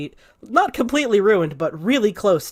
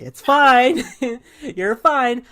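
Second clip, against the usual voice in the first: soothing reassurance; 6 semitones higher, 4 dB louder, much slower than usual. Acted. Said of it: "patting your back like".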